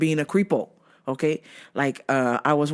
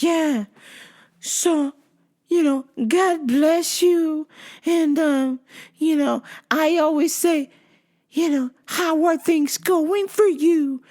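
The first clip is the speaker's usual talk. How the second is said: high, slow, sing-song small talk; creepy stalker; 9 semitones higher, slower than usual. Retold or imitated. Stalker making small talk with the worker, creepy sweetness. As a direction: stretch the pleasantries in a higher put-on voice.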